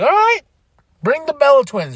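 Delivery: high-pitched, loud enthusiasm; excited welcome. Retold or imitated. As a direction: welcome them in with a high whoop. Acts own excited reaction.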